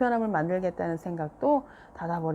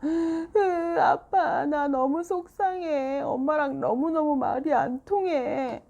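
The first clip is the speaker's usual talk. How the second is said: higher, slow sobbing words; crying child; 9 semitones higher, 4 dB louder, much slower than usual. A narrator's reading. Seoyeon crying to dad about mom, sobbing. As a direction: cry it out to dad. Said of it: "울음이 터졌어요".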